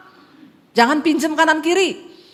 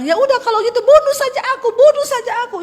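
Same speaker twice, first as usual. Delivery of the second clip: high, loud, fast shriek; hysterical wife screaming; 11 semitones higher, 6 dB louder, faster than usual. Acted. Wife shrieking at her husband to just kill her. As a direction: scream it like you've lost control.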